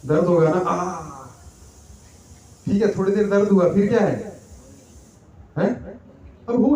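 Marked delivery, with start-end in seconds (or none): 0.6-1.3 sharp pained ah, in a changed voice; a quick yelp of pain; pain cry. Pretend. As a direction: cry out as if a dart just hit you. Imagined dart in the back.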